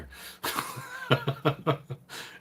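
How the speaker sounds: breathless unvoiced laugh, high; helpless airy giggle; silent wheezy laugh. Real, not acted. Laughs at his own story.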